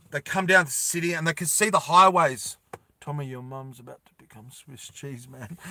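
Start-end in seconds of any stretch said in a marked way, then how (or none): 3.0-5.6 mock aside. Joking aside in character voice.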